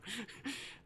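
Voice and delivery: soft, high little laugh; demon chuckle. Acted. Demon chuckles after threatening to devour, amused. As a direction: let a quiet giggle slip out.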